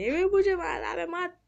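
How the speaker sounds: high-pitched yelps of alarm; playful panic, voice shooting up high; panicked cry. Pretend. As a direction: cry out as if under attack. Attacked in game, cries out.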